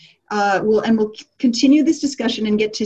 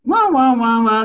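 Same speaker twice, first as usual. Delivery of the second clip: droning grown-up wah wah; muffled wah wah talk; 3 semitones higher, 5 dB louder than usual. Retold or imitated. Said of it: "interactions go something like this".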